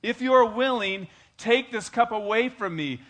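loud high anguished plea; Jesus begging the Father, loud and high; Jesus praying. Acted. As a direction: beg to be spared, loud. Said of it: "Jesus to go through".

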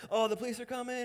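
high, mock-panicked wail; hammed-up high-pitched panic; panicked driver. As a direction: imitate someone freaking out.

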